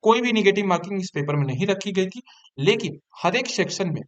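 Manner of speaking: ordinary talk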